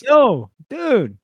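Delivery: casual yo dude. Retold or imitated. Friend showing how he would call the lady, loud high bro call. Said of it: "I would've said".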